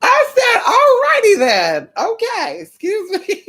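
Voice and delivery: high squealed words through laughter; cracking up. Real, not acted. Retelling Larry's correction to viewers, squealing with laughter. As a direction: squeal it out while laughing hard.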